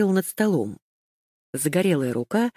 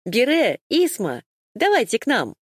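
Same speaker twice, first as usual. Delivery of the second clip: calling to the table; 7 semitones higher than usual. Acted. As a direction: wave friends over to the table. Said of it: "Айола позвала их к столу". (громко зовёт к столу)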